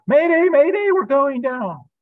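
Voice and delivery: high, loud pilot's distress cry; mayday call. Acted. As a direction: yell it like a crashing pilot. Groggy raccoon replays crash.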